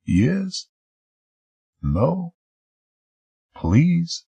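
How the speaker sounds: a hint of a meek voice; lightly voiced gentle man. Narrator voicing him. Quoted words of a character.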